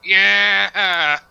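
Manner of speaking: loud victorious yell; excited cheer. Real, not acted. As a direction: celebrate the kill. The kill roll succeeds.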